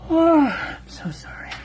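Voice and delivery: whispered apology through a yawn. Real, not acted. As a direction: say it mid-yawn, breathy. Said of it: "Stupid yawn".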